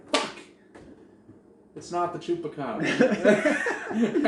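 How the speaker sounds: loud, high, drawn-out comic lament; mock disappointment. Fan learning the legend is debunked, hammed-up loud dismay. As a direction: wail it slowly with a laugh creeping in. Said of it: "I was so disappointed".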